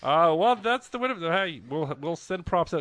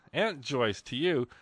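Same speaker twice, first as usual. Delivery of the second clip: usual speaking voice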